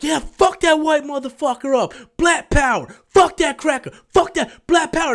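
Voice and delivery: mock rowdy cheering, high and forceful; rowdy crowd. Moviegoers cheering on the character fighting back, imitated rowdy shouting. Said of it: "everybody's like".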